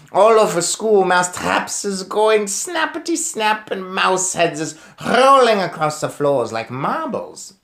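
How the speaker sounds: loud, gleeful Grand High Witch voice; gloating witch. Acted. Witch leader gloating over her plan to the cheering crowd. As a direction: relish every snap like an evil witch.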